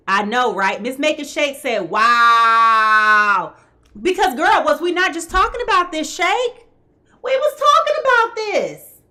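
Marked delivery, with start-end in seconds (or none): 1.9-3.5 loud, long drawn-out wow; big sustained exclamation of astonishment; amazed wow. Retold or imitated. Reading out a viewer's amazed reaction. 4.0-9.0 high-pitched, emphatic disbelief; real excited can-you-believe-it outburst; amazed excitement. Real, not acted. Amazed that the reading matches earlier talk.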